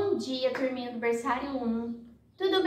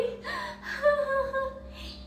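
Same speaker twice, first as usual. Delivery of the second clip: a high, hammed-up sob; high mock sob; 11 semitones higher, 3 dB quieter than usual. Butterfly sobs to the snail, hammed-up and high. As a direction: sob like a hurt little butterfly. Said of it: "Ela, chorando muito, falou".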